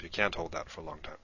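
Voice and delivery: ordinary talk, nothing marked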